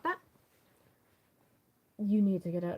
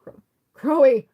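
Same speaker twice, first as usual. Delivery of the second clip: loud high call; 5 semitones higher, 13 dB louder than usual. Acted. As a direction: shout his name down the hall. Character calls out after friend.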